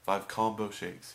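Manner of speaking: put-on loud, slow French-accented insistence; imitated thick French accent, drawn out. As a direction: mimic a French customer insisting loudly. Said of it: "he had a thick accent".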